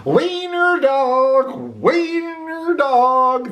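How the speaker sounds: high, slow sing-song taunt; taunting dogs. A narrator's reading. Dogs jeering at Oscar in high sing-song. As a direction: tease him in a mocking chant. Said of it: "they called him".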